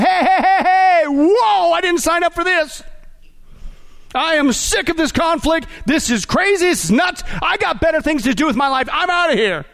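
high, panicky mock soldier's holler; crying out in protest. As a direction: yell like a soldier refusing to fight. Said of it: "if the soldiers cried out".

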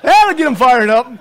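ordinary delivery

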